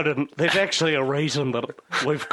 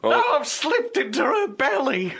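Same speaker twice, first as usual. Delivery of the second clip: squeaky swallowed frog; 4 dB louder than usual. Acted.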